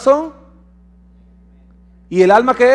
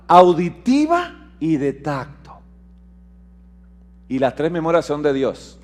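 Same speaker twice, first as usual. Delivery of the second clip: ordinary delivery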